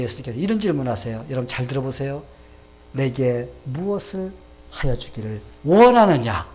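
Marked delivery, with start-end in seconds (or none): 2.9-6.5 slow, weighty question swelling louder; solemn question. Acted. Jesus questioning the blind beggar, slow and solemn. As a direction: ask deliberately, phrase by phrase.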